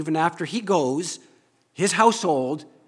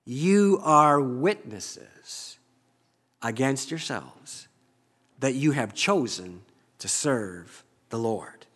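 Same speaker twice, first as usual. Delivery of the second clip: slow, grave, fading charge; Joshua's solemn charge; slower than usual. Acted. Joshua holding the people to their word, slow grave charge. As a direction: lay it on them slowly and gravely. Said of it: "Then Joshua says".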